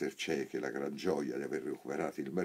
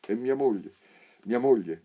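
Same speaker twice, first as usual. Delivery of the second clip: richiamo gridato, più alto e forte; grido; 6 semitones higher, 7 dB louder than usual. A narrator's reading. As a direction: grida cercando tua moglie. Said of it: "gridò il primo cieco".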